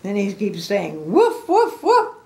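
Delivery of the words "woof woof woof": high, loud woofs; dog barking. Acted. Big mean dog barks at Piggy, high loud barking. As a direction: bark it out in sharp bursts. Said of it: "barking, saying".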